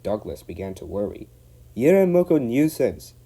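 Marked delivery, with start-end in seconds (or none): 1.8-3.1 Scottish burr, loud and high; Scottish engine voice. Acted. Donald scolding the van, loud Scottish. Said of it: "said Donald one day".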